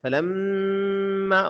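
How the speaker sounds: فلما high and slow, humming on the mm; ghunna on meem. Shown as a demonstration. Teacher models ghunna for learners, long nasal m in فلما. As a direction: hold the doubled م of فلما in the nose. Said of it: "غنہ کریں".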